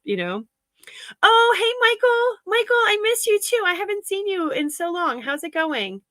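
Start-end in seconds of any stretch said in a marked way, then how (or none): 1.2-6.0 much higher, bright delighted hello; squealing hello to a friend; excited greeting. Real, not acted. Greeting friends arriving on stream.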